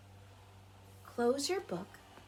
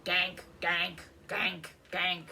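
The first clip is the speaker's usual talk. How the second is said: lower, gruffer duck quacks; big duck quack; 4 semitones lower, 3 dB louder, slower than usual. A narrator's reading. The big ducks hanging back, low quacks. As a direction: quack it deeper like a grown duck. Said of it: "The big ducks say".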